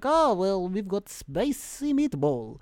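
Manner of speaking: hammed-up Italian accent, drawn out. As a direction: stretch it out in a mock Italian accent. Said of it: "She's suddenly Italian".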